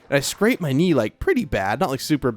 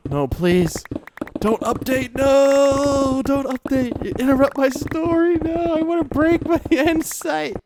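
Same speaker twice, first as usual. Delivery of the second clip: squeaky high plea for the game not to update; high pleading whine; 12 semitones higher, slower than usual. Real, not acted. Streamer begging the game not to update, high whine. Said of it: "pleading with game not to update".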